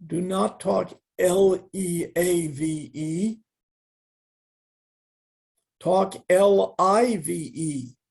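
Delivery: a gently stern guru spelling it out; Prabhupada's correction. Retold or imitated. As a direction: spell each letter slowly and firmly like a teacher correcting. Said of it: "Prabhupada said".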